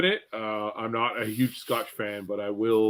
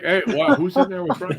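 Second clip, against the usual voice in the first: surprised wife; 8 semitones higher, 5 dB louder than usual. Retold or imitated. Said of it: "Shelley's like".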